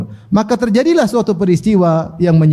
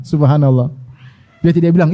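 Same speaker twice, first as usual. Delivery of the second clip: ordinary talk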